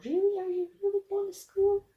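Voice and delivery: high-pitched mock disbelief; squeaky incredulous question to a little one; doubtful parent. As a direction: ask it up high, as if astonished. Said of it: "I sometimes look at her and go".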